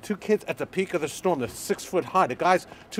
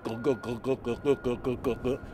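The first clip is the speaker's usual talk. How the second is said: low, quiet glug-glug of rising water; imitated gurgling water; 4 semitones lower, 4 dB quieter than usual. Storm survivor recalling water in the pipes, imitated gurgle. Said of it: "all you hear is".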